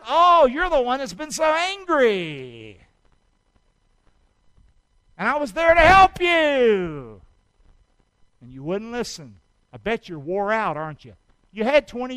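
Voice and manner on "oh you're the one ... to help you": slow, high, louder voice of Jesus; voicing Jesus. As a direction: reproach them gently as Jesus. Preacher voices Jesus' reply.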